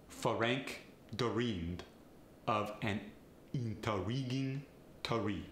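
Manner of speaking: vowels slipped in, Feh-rank deh-reamed, teh-ree; Farsi accent. Shown as a demonstration. Coach demonstrating a Farsi accent, a vowel before each R. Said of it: "Inserting vowels is especially fun".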